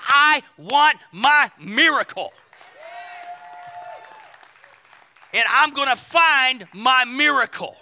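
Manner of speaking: slow, loud, word-by-word declaration; preacher's emphatic acting of fierce resolve; determined demand. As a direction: hammer out each word as a demand. Acts out woman's determined demand.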